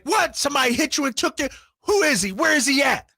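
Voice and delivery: fast, high-pitched angry dad; livid dad. Retold or imitated. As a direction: shout it like an outraged father ready to fight. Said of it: "he was livid".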